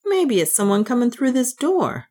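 high, uneasy character voice; nervous. A narrator's reading. Mick worried someone is coming through the door, nervously.

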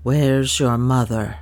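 low, slow, mildly faltering question. A narrator's reading. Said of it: "with a faltering voice".

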